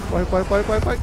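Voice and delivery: urgent loud shouting; being chased. Real, not acted. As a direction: yell it while running away. Fleeing in game, urgent.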